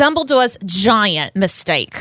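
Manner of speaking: slow New York headline read; New York accent. Acted. Reading the headline as Rita Skeeter, New York accent. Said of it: "Rita has a New York accent".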